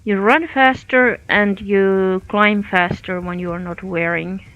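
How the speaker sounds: ordinary talk, nothing marked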